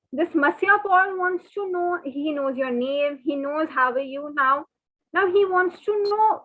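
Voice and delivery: ordinary talk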